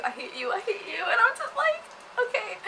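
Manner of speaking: real delighted laughter; laughing. Real, not acted. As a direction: gush while cracking up. Fangirling over favourite scene.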